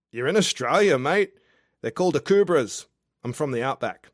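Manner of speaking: a tipsy outback charmer calling up to a window; drunk Aussie guy. Acted. Drunk Australian guy below her window, boisterous and cheeky.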